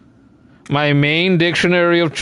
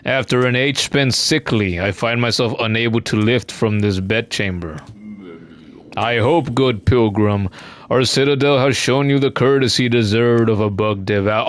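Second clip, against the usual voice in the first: weary NPC voice; 6 semitones lower than usual. Acted. Game elder addressing the pilgrim, lightly voiced and solemn. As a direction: read it as a tired dying elder. Reads NPC dialogue aloud.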